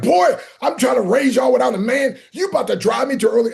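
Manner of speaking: mother yelling at her son; scolding mother. Retold or imitated. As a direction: scold your boy in exasperation.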